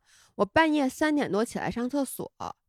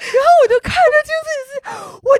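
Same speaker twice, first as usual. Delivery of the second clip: laughing; 7 dB louder, much faster than usual. Real, not acted. Laughing while retelling mirror scare.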